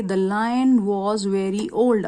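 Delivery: very slow example sentence; slow classroom reading of an example. Shown as a demonstration. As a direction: read the next example slowly. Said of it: "आगे देखिए".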